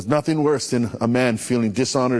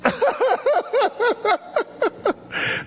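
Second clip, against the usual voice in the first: laugh. Real, not acted. Pastor laughs at own line.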